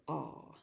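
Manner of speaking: "Ah" said softly and low on its own; quietly single out the vowel "Ah"; low, soft "Ah" to show the sound; isolated vowel. Shown as a demonstration. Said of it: "particular features of pronunciation".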